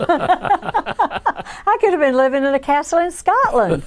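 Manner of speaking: high, slow and laughing through the line. Real, not acted. Laughing about the castle she missed, high and slow. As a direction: let the laugh lift and stretch every word.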